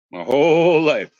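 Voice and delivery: ordinary talk, nothing marked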